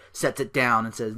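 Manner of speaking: normal talking voice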